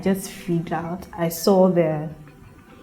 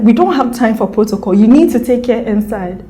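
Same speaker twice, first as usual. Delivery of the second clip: louder, pressing doctor's order; urgent doctor; 5 semitones higher, 8 dB louder than usual. Retold or imitated. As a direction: bark the order like a rushed doctor. Doctor urging staff to hurry.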